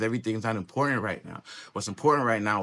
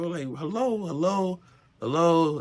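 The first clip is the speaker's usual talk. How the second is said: building hellos, each louder; mock calling hello; 7 semitones higher, 3 dB louder than usual. Calling hello louder each time to wake someone. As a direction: keep calling hello until you're shouting it.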